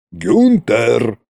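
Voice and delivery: barked name, gruff king; roaring tyrant king. A narrator's reading. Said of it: "рявкнул царь-батюшка".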